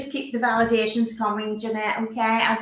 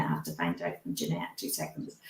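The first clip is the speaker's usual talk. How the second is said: much softer, quick murmured aside to the spirit; hushed aside; 13 dB quieter than usual. Real, not acted. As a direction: drop the voice to a quick murmur, then whisper.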